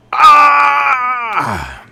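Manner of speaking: a livid outburst; frustrated yell. Real, not acted. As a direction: let out a raw shout of fury. Venting after the refund decision.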